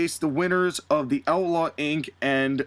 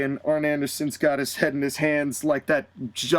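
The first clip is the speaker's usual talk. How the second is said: ordinary talk, nothing marked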